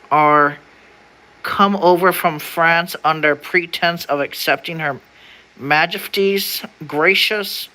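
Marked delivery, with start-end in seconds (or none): none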